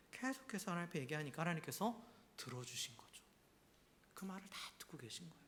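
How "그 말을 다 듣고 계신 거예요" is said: soft unvoiced whisper. Real, not acted. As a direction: confide it in a hush. Preacher whispers God listens. (속삭임)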